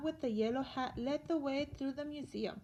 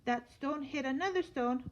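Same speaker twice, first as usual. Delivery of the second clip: ordinary delivery, nothing marked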